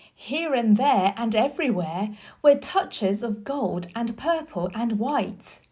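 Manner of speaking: usual speaking voice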